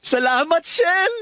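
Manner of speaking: a high, delighted burst of thanks; thrilled thanks. Acted. Man overjoyed that she finally says yes, high and bright. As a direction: jump with joy at her yes.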